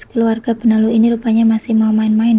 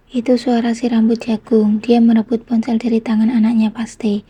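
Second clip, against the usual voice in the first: usual speaking voice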